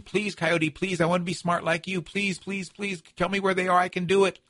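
high, pleading Badger voice; trickster tale voices. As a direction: beg like a desperate little animal. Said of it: "keeps begging and pleading".

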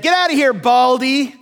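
high, loud schoolyard taunt; jeering boys. Retold or imitated. Boys jeering at the bald prophet, loud taunt. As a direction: jeer it like mocking kids. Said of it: "they said".

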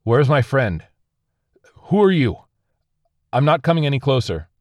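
high, loud demand; urgent shouted questioning. A narrator's reading. Crash survivor demands his friend from a stranger, urgent shouting. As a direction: shout across at the unknown voice. Frightened survivor challenges unknown voice.

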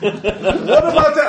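high, loud, drawn-out yell. Acted. Player's character joins the shouting match, yelling. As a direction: yell it into the argument. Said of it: "I yell out".

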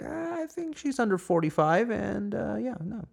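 squeaky hesitant officer voice; cop sizing her up. Retold or imitated. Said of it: "and be like".

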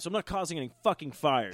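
usual speaking voice

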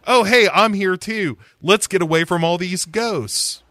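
high, bright cheerful arrival; chirpy young woman voice. Retold or imitated.

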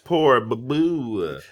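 slow, drawn-out mock sympathy; mock pity. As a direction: coo it slowly like fake pity.

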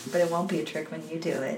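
hushed, grave quote, said quickly; quiet and serious. Retold or imitated. Pippin's dark turn, quiet and serious. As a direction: drop the volume, dead serious. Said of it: "he says it real quiet and real serious".

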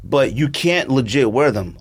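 ordinary talk

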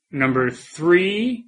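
slow drawn-out list announcer; countdown announcer. Acted. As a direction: build suspense on each number.

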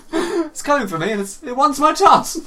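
high, loud wail breaking into laughter; mock panic. Retelling his night in bed after the film, mock panic. Same acted bedtime panic.

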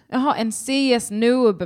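the penny drops, say it as the name; sudden realisation. Shown as a demonstration. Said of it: "det bara är, eh, så här små bokstäver".

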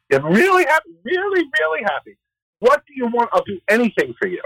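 very high-pitched, hammed-up gushing; gushing act. Retelling Esther and the delighted king at the party, hammed-up high gushing. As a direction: pile on the delight in a high put-on voice.

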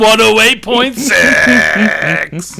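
very slow, drawn-out mock radio shout. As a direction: stretch it out and bellow the call sign.

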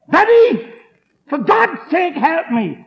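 high, loud pleading cry; desperate cry. Retold or imitated. Drunk friend pleads with his buddy, high and desperate. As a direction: cry out for rescue.

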